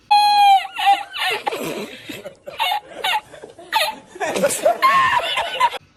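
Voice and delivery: howling laugh; loud laughing fit. Real, not acted. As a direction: laughs hard, loud and high. Laughing at own voice crack.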